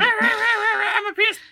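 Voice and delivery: very high, slow and loud cartoon squeak; silly squeaky voice. Co-host chiming in with a joke, absurd high slow squeaky voice. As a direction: stretch it out in a ridiculous high-pitched character voice.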